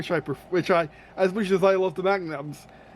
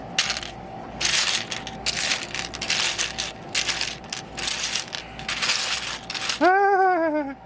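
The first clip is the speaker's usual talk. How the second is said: breathy laugh; 13 semitones higher, 4 dB quieter than usual. Real, not acted. Laughing alone at own remark.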